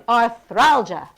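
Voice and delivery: "arthralgia" slowly, THRAL stretched out; jaw-exercising articulation. Shown as a demonstration. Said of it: "You're exercising your jaw".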